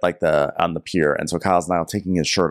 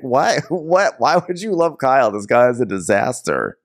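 incredulous; 5 semitones higher than usual. Retold or imitated. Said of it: "I'm like".

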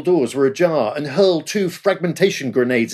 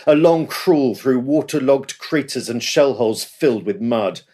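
ordinary delivery

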